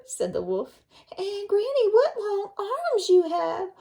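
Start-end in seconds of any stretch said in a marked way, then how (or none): 1.2-3.7 put-on amazed little girl. Red questioning disguised wolf.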